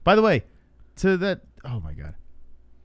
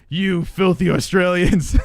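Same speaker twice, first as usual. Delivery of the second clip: slow, louder put-on troll sneer; mock troll voice; 4 semitones higher, 5 dB louder, slower than usual. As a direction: drag out "filthy" like a hater. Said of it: "mocking the commenters".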